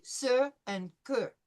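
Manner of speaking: sir and que with an open vowel, R at the end; French sir and que. Shown as a demonstration. Teacher models sir and que for students. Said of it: "replicate the I sound in sir".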